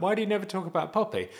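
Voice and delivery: high, fast questioning voice; mock viewer asking why he never talks about Poppy; imitating viewers. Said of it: "I know a lot of you are like".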